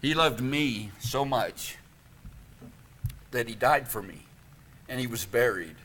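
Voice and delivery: a preacher tells the gospel softly to the congregation; hushed and tender. Acted. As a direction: bring them in close and speak gently. Intimate close of the sermon.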